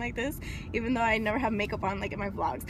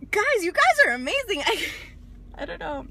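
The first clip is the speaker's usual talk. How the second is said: overjoyed gush; 8 semitones higher than usual. Real, not acted. Thanking her viewers, gushing and overjoyed. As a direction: burst out with gratitude. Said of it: "full of excitement and emotion".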